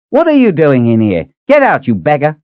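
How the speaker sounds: high, loud scolding yell at a beggar; angry cook shouting. Acted. Kitchen woman yelling at a supposed beggar, loud angry scold. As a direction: shoo the intruder out, sharp and loud.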